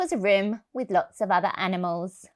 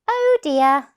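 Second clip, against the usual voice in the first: mother's voice; 6 semitones higher, 7 dB louder than usual. Acted.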